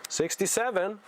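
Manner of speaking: a comic strained sixty-seven; silly voice. Shown as a demonstration. Before the regular take, Sixty-seven in a high silly voice. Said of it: "I will say it in a regular millennial voice".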